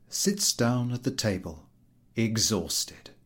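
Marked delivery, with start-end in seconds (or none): none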